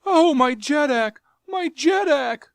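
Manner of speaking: high, loud terrified pleading; whimpering plea. Acted. Jav grovelling before his Jeddak, whimpering. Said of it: "he whimpered".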